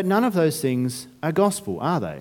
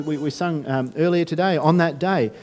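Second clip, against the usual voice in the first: ordinary talk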